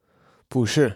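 不是 with 不 rising into a falling 是; easier tone change. Shown as a demonstration. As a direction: lift 不, then drop 是. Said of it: "It's easier to pronounce".

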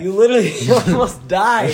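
laughing. Real, not acted. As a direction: laugh through the words. Recalling friend nearly dying.

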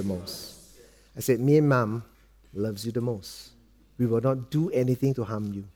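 low, soft, measured fatherly voice; tender reassurance. Retold or imitated. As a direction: speak softly to reassure a small child. Father gently reassures frightened young son.

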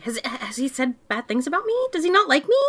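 high, pleading little voice; whiny worried. Retold or imitated. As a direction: play the insecure kid fretting. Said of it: "I'd be like".

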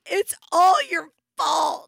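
high, loud, drawn-out tantrum wail; screaming child. Retold or imitated. Mother imitating daughter's tantrum over dresses, screaming. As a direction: imitate a kid screaming blame. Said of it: "she starts screaming".

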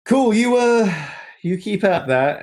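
high, hesitant mock encouragement; awkward politeness. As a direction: humour him politely while edging away. Acts out people backing away politely.

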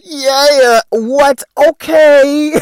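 loud, high hype-man shouts. Retold or imitated. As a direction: hype the crowd up like a crunk rapper. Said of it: "out with my grill as [name]".

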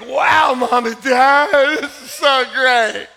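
put-on slow, loud gushing as the kids; hammed-up grateful children; mock thrilled kids. Kids gushing at mom and dad at the park, mock delight. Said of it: "they were like".

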